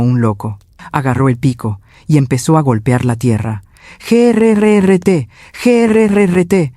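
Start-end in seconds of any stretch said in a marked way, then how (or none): 4.0-6.7 high, slow growling grrrt of a pick; pickaxe noise. Acted. Narrator voicing the pick hitting graveyard soil, high slow growl. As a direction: grind it out like metal on earth. Pickaxe striking earth imitated.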